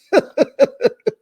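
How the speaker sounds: loud high cackle; loud laugh. Real, not acted. Vlogger laughing at the hyphy house joke, loud high cackle. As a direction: burst out laughing.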